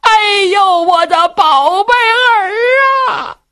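high, drawn-out, slow wailing sob; wailing cry. Acted. Old lady cries out for her son, loud slow wailing. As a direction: howl for your only son. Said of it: "老太太一听是放声痛哭啊".

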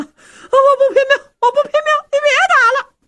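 very high, loud wail of surrender; panicked begging. Acted. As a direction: squeal for mercy from the blows. Beaten wife begs husband to stop. (尖声哭喊求饶)

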